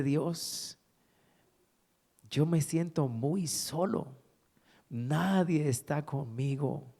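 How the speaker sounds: slow, softened plea to God; retold lonely prayer. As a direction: confide loneliness to God, slowly and quietly. Retells lonely, tearful prayer. (oración solitaria, lenta y dolida)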